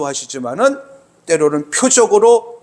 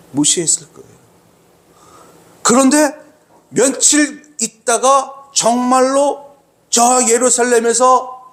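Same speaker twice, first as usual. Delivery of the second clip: ordinary delivery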